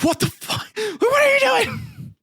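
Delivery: high-pitched, strained outcry, drawn out at the end; acted shriek of a horrified dad; mock horrified yell. Acts out horrified father Peleus.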